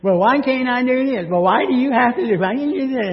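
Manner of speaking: put-on a child protesting to a parent, high and whiny; whiny kid. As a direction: whine it like a sulking kid. Kids whining at parent.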